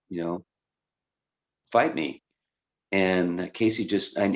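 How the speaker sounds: usual speaking voice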